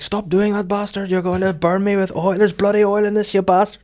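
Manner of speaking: high-pitched imitation of an angry protest; hammed-up high complaint; panicked van man. Chip van man yells at the van shaker, high protest.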